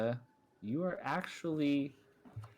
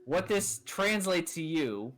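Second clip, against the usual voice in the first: ordinary delivery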